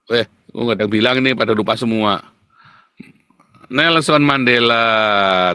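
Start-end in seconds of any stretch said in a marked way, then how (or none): 3.7-5.5 slow, drawn-out reading of a viewer's answer; reading out the name. Retold or imitated. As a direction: stretch the name out as if reading it off the screen. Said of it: "terima kasih, Ernifar".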